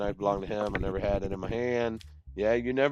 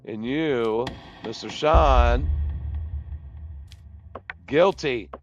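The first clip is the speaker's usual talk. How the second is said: louder, measured verdict; judge verdict; 3 semitones higher, 10 dB louder, slower than usual. Acted. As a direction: hand down guilty with weight. Judge delivers sentence.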